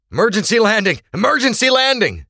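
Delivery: high, loud emergency shout; urgent, full-voiced crew warning; yell. A narrator's reading. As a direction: yell the alarm to the whole plane. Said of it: "a crewman yelled".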